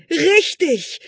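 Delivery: high, loud cry of approval; exclaiming. Acted. Tutor calling out to the prince, high and loud. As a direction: burst out in delight. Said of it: "rief der Hauslehrer aus".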